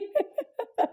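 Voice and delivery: a sudden high-pitched giggle at herself. Real, not acted. Vlogger laughing at her own blunder, high giggle. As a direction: burst into a squeaky laugh at your own mistake.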